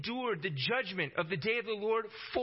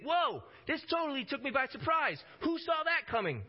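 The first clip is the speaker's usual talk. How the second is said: mock surprise; 8 semitones higher than usual. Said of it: "be like".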